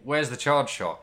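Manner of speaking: ordinary talk, nothing marked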